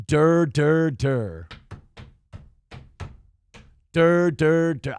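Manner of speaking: dopey mocking singles, trailing down low; dur-dur voice. Shown as a demonstration. Host savours the mocking voice, dopey dur-dur. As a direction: drop each dur into a dull-witted drone. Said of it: "dur, dur voice is for".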